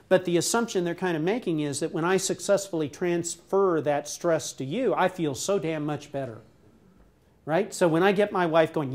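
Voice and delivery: ordinary delivery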